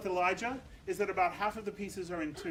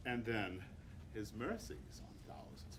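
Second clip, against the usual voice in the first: much softer and lower; soft contrast; 8 semitones lower, 10 dB quieter than usual. Shown as a demonstration. Conductor softens mercy line for choir, hushed. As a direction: hush it down for the mercies. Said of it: "conductor contrasts with the mercy line".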